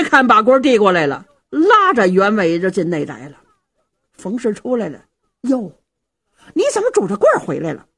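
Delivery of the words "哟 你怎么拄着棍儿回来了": mildly surprised wife, high and quick. Acted. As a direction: exclaim in surprise at a husband's return. Wife sees husband back on stick. (略带惊讶地尖声问)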